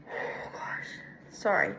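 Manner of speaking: real breathless near-whisper; out of breath. Real, not acted. Student apologizing mid-presentation for congested breathing, breathless and hushed. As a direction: let it drop away, struggling for air. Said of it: "I can't breathe".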